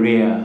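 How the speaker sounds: "rare" said as rea, only the first R sounded; silent final R. Shown as a demonstration. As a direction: drop the last R of "rare". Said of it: "আমরা আর উচ্চারণ করবো না". ("rare" শব্দের শেষের র অনুচ্চারিত)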